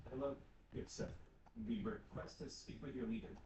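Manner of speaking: a lightly voiced stiff diplomat; low, stiff request to see the leader; pompous envoy.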